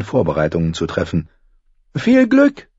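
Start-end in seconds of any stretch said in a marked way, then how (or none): 2.0-2.7 high call after someone. A narrator's reading. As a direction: call after the running boy. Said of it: "rief Sansa ihm nach".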